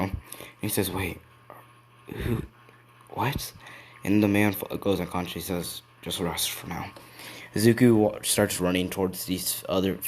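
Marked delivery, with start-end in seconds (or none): 0.9-3.5 soft, halting bewilderment rising at the end; quiet, puzzled disbelief; faint confusion. Acted. Fainting hero is confused by revelation. 6.0-6.9 quiet, gentle send-off; hushed and soothing; soft reassurance. Acted. Rescuer soothes an unconscious hero.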